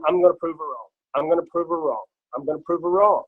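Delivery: grim, steady repeated vow; determined. Retold or imitated. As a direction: set your jaw and repeat it. Vowing to beat wife's doubt.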